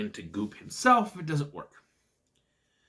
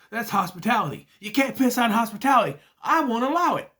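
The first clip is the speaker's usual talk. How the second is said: hammed-up shout, higher and louder, voice cracking; mock outrage; 8 semitones higher, 5 dB louder, faster than usual. Reviewer imitating the film's outraged grandpa line. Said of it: "one of the famous, infamous lines".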